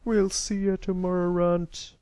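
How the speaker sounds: scornful parting threat. A narrator's reading. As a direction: call the taunt back while running off. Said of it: "the grey colt scorned as he ran off".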